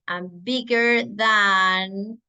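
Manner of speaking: slow, careful English; teacher modelling slowly; slow model phrase. Shown as a demonstration. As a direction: say it slowly for learners. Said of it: "So I'm going to say".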